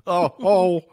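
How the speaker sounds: high squeaky exasperated groan; squeaky falsetto mockery. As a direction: whine it high and loud. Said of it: "I'll play Simon".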